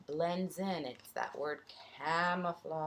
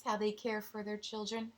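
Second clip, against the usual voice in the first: ordinary delivery, nothing marked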